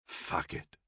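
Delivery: low, soft swearing under the breath; said to himself. A narrator's reading. Johnson swears to himself inside the tank, low and private. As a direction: curse softly, for your own ears only. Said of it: "he said aloud to himself".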